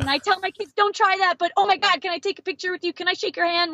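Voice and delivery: a starstruck coach pleading for a photo; gushing coach. Retold or imitated. Coach asking for a picture and a handshake, starstruck.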